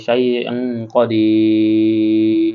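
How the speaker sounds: শাই ইং কদির with a long nasal hum on ইং; nasal ghunna. Shown as a demonstration. Teacher showing ghunna while reciting slowly. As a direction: hum the ন sound through the nose, slowly. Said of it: "সেজন্য আমাদেরকে গুণনা করতে হবে".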